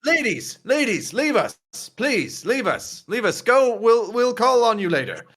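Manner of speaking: high, sing-song grandfatherly shooing; dismissive grandfather. Acted. Grandfather sends the ladies away, high and insistent. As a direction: shoo the company out in repeated falling swoops. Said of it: "your grandfather".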